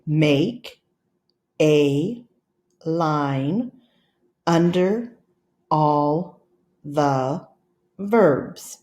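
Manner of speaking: slow, one word at a time with pauses. Shown as a demonstration. Teacher reading to class, one word at a time. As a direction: read it out word by word for pointing. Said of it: "Please point to the first word".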